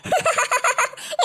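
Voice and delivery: high gloating laugh; long ha-ha cackle at a sibling's trouble; gleeful cackle. Acted. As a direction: burst into mocking laughter. Sister gloats over punished sibling.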